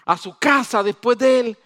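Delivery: normal talking voice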